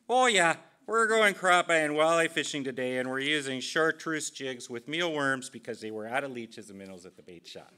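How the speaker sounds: loud, high Minnesota accent, easing toward the end. Shown as a demonstration. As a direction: burst in with a hammed-up old Minnesota accent. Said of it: "which used to be something like this".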